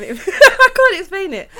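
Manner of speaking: loud, high, drawn-out words through laughter; real laughing. Real, not acted. New mum giving up explaining how she pictured the couple, laughing helplessly. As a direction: crack up while trying to speak. Cracks up at her own story.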